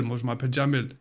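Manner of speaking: ordinary talk, nothing marked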